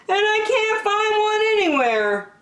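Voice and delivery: put-on high childlike plea; a small bear begging for a hug; high wistful bear voice; pleading Douglas.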